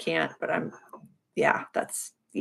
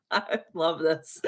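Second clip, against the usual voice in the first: tearful; 4 dB quieter, slower than usual. Real, not acted. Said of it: "I could cry".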